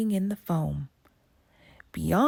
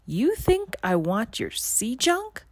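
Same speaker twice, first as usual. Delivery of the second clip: mild mock roar, higher; roaring witch; 6 semitones higher than usual. A narrator's reading. Sea witch mocking Melody's treasures, mild roar. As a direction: scoff at her trinkets. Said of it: "the witch roared".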